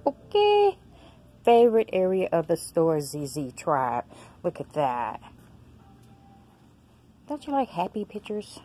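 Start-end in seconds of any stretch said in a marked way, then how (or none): none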